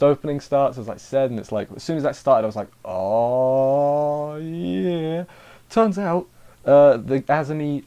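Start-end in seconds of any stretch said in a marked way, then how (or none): 2.8-5.2 one long, drawn-out swell, climbing in pitch; hyped-up relish. Retold or imitated. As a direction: let it build slowly like an engine revving. Own reaction as opening song starts.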